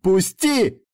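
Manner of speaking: loud, high cry; shouted demand. A narrator's reading. Woman shoves him away, shouting to be released. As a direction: cry it out at full push. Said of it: "крикнула и толкнула меня".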